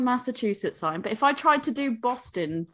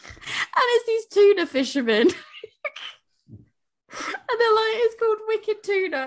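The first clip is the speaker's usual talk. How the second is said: helpless laughter; 10 semitones higher than usual. Real, not acted. Explaining Wicked Tuna to friends, voice high with laughter. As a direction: squeeze the words out while laughing.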